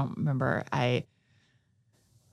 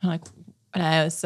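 usual speaking voice

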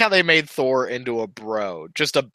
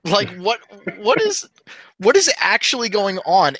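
high, louder, incredulous outburst; exasperated bafflement; 8 semitones higher, 4 dB louder than usual. Retold or imitated. Retelling confusion at Justice League, exasperated bafflement. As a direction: voice jumps up in mock disbelief.

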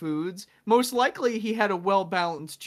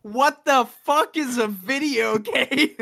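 slow, louder, higher outburst of disbelief; mock baffled Augustine; 5 semitones higher, 5 dB louder, slower than usual. Imagined Augustine hearing about video games, slow baffled outburst. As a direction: drag out the confusion, half laughing. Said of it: "He's just gonna be like".

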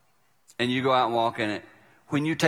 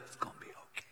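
soundless mouthed words; mouthed silently; 22 dB quieter, much faster than usual. Retold or imitated. Mouthing to the kid in the next car, silent. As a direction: mouth it without voice. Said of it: "I literally mouthed the words".

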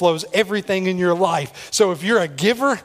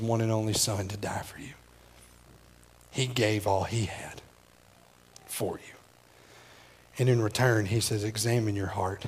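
hushed preaching; 8 semitones lower, 7 dB quieter than usual. Real, not acted. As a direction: bring it right down and draw them in. Drops into low hushed preaching.

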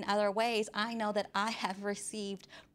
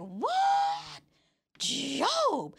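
high, drawn-out mock disbelief; shocked reader; 4 dB louder than usual. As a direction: cry out in hammed-up shock at a friend. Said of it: "I'm reading this like".

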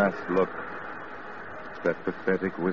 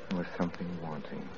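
hushed regret; 4 semitones lower, 11 dB quieter than usual. Acted. Frank's quiet regret.

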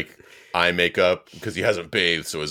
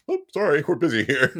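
mock Perrin voice; 8 semitones higher than usual. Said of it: "Perrin's just like".

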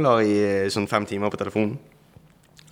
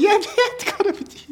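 excited reply. Retold or imitated. Mats answering, excited, laughing.

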